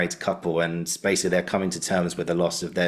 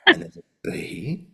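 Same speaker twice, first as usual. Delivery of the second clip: slow, higher Australian tutor dwelling on "beat"; exaggerated imitation of a teacher emphasising "beat"; Andrew Davidson; 7 semitones higher, slower than usual. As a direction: draw "beat" out into a high swoop. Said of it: "he was always emphasized that".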